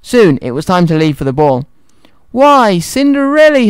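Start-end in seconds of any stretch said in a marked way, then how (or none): 2.3-3.7 high, drawn-out mock surprise; haughty stepmother, high and slow; sneering. A narrator's reading. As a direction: sneer it slowly with false sweetness. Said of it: "her stepmother sneered".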